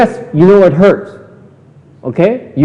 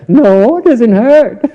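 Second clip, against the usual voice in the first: higher and louder, laughing through 'it doesn't hurt'; laughing mock denial; 6 semitones higher, 6 dB louder, slower than usual. Teacher imitating the student's fake denial while laughing. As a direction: play the fibbing student, giggling. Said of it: "Doesn't hurt, huh?".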